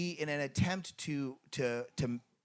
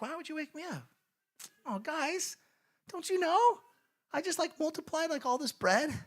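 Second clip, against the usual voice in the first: high, light, mock-puzzled voice; playful Jesus; 4 dB louder than usual. Jesus teasing his disciples in the storm, high and mock-puzzled. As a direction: play Jesus amused that the disciples woke him. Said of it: "He's like".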